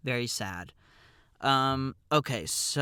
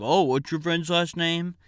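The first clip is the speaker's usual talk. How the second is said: loud, raised-pitch imitation of a desk clerk; old curmudgeon; 6 semitones higher, 7 dB louder than usual. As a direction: mimic a grumpy clerk interrogating. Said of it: "he's more of a curmudgeon".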